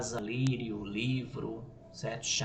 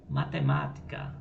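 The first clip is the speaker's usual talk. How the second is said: matemática with a strong má, third syllable from the end; stress on MÁ; 9 semitones lower than usual. Shown as a demonstration. Teacher giving a proparoxytone example, stress on the má of matemática. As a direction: lean on the má of matemática. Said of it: "ser a antepenúltima sílaba tônica".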